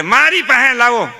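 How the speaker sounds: mock eager shout of give him to me; competing relative. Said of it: "બધા હરીફાઈ કરે".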